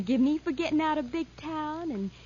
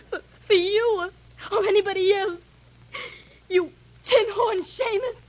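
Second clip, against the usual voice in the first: tearful defiance; 6 semitones higher, 4 dB louder than usual. Acted. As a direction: fling it at him, voice raised. Girl hurt, defies threatening man.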